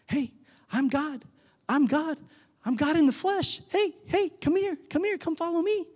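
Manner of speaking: high-pitched, coaxing dog-owner voice; calling a dog. Acted. Jesus coaxing disciples like a pet, high and excited. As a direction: call brightly, as if to a pet. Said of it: "maybe we were like copper".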